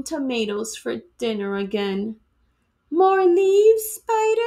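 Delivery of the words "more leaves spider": higher, louder motherly offer; cheery host mom. Acted. Worm's mother offers her guest more leaves, cheery and high. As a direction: sing it out like a proud cook.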